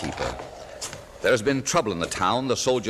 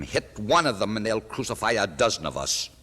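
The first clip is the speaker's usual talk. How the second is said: ordinary talk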